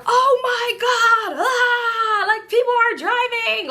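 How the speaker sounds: high, slow hammed-up panicked wail; mock panic. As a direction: overdo a hysterical passenger. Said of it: "I'm not gonna be like".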